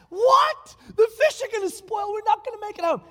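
high, loud protest of scared fishermen; panicked crew crying out against the storm; upset crew shouting. Retold or imitated. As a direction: voice the crew yelling back in alarm. Crew angry at captain's plan.

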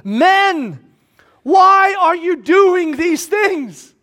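high, loud, slow-stretched cry; shouting. Acted. Paul crying out to the men of Lystra, shouting. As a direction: cry out to stop a crowd. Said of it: "shouting at the top of his voice".

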